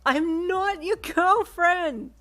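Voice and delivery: very high, loud, slow tearful scream; sobbing shriek; scream-crying. Acted. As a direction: scream it through tears. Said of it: "you scream cried".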